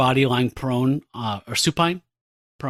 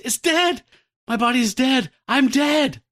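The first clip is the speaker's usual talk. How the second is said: high-pitched cries of alarm; hammed-up high panic; panicked; 11 semitones higher, 3 dB louder than usual. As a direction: exclaim in acted horror, phrase by phrase. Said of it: "she just panics".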